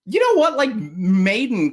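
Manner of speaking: excited aha; sudden realization. Real, not acted. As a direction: blurt it as the answer hits you. Sudden idea after question.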